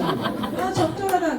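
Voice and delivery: high, pleased little remark with a laugh; mock-satisfied approval; smug satisfaction. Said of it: "그 잘못된 거예요".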